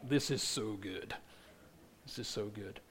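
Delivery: very low, barely audible; soft, awed aside; hushed awe. Retold or imitated. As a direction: murmur it to yourself, savouring. Voicing awed movie viewers.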